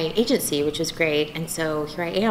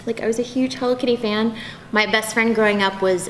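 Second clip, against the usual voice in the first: usual speaking voice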